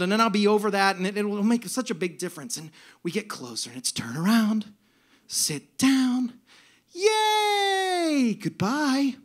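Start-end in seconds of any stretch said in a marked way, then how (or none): 3.9-9.2 singsong cheer, lifting high on the later words; angelic, joyful. Retold or imitated. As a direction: angel voice, bright drawn-out yay. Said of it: "she's really happy to be here".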